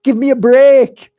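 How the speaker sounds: high, loud mocking rant, half laughing; mocking scoff. To pilgrims, mocking that it's the same stone: high loud scoff. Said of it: "and I can say".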